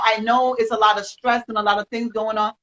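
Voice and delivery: ordinary delivery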